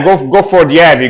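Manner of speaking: ordinary talk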